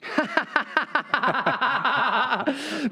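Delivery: helpless run of ha-ha laughter; long real laugh. Real, not acted. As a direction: burst out laughing and keep it going. Host laughs at the wife excuse.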